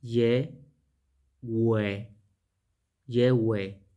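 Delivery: "ye, we" in separate held syllables, silent H; clean pronunciation demo. Shown as a demonstration. Teacher showing the name without pronouncing the hey, syllable by syllable. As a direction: sound out "ye", then "we", then join them. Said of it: "sin pronunciar la hey".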